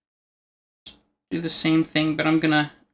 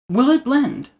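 high, announcer-style "Will it blend?" with "it" stressed; Will It Blend host; 8 semitones higher, slower than usual. Retold or imitated. Imitating the blender show host for viewers. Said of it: "You guys watch that channel?".

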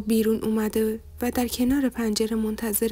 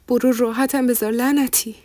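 loud, irritated brush-off; angry dismissal; 6 dB louder than usual. A narrator's reading. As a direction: tell them off in a raised voice. Woman curses questioner, turns away. (با عصبانیت و صدای بلند)

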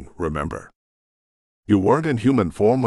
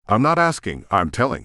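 ordinary delivery, nothing marked

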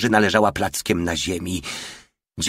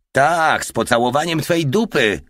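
high, raised-voice mockery; loud guffawing jeer; 7 semitones higher, 4 dB louder than usual. A narrator's reading. Soldier mocks his comrade's question, loud jeer. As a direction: bray the crude retort. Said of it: "rżał głośno Porta".

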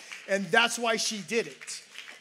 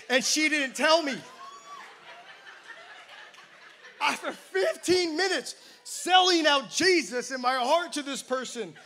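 loud and laughing, louder at the peak; laughing outburst; 5 semitones higher, 3 dB louder than usual. Real, not acted. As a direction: laugh through the punchline in real disbelief. Laughing at his own story.

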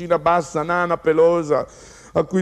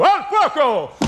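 retold shout; 11 semitones higher, 4 dB louder, slower than usual. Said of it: "poi ha gridato".

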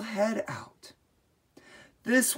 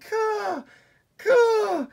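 very high, loud eagle caw; eagle cry; 4 dB louder than usual. Acted. Mother eagle calling to her babies, loud high caws. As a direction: call like a mother eagle from the sky.

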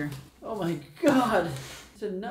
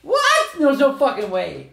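loud shocked protest; loud disbelief; 5 semitones higher, 9 dB louder than usual. Real, not acted. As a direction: refuse to believe it, loudly. Disbelief at five percent.

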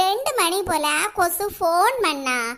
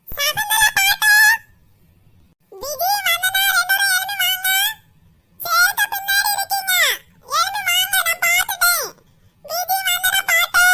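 excited calling out; 9 semitones higher than usual. Acted. Child seeker shouting found friends' names, high and excited. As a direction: shout their names like you caught them. Seeker spots hiding kids.

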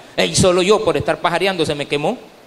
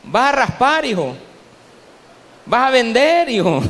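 higher, teasing call; mocking bakers; 6 semitones higher than usual. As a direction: jeer at the lad, voice lifting. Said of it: "Lo primero eran burlas de todos los panificadores".

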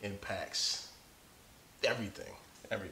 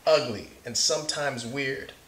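bright, lively read-aloud, a bit higher and louder; story narration; 5 semitones higher, 7 dB louder than usual. Acted. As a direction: read it like to a class of kids. Reads picture book aloud.

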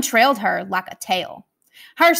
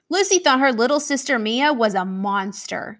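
plain reading voice

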